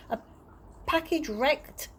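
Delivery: ordinary delivery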